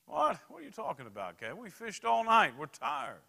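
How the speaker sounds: high, loud protesting fisherman; exasperated disciple. Acted. Tired disciple protesting to Jesus, loud exasperation. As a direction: throw up your hands, worn out and incredulous.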